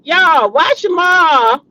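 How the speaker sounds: "why Shamar" stretched slow and loud; drawn-out call. Real, not acted. To her viewers, a slow, loud incredulous call about Shamar. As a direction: call it out, dragging each word.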